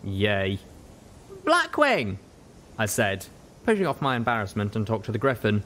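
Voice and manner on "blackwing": hailing. Acted. Pip hails the griffin.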